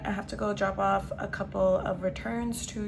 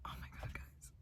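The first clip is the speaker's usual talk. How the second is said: breathy excited whisper; 22 dB quieter than usual. Real, not acted. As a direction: whisper it like a secret. Excited whisper about candy.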